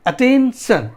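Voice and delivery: Attention slowly, with weight on शन; stress on final syllable. Shown as a demonstration. Teacher showing where stress falls, last syllable stressed. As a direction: punch the शन of attention. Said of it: "शन पे ज़्यादा पड़ रहा है".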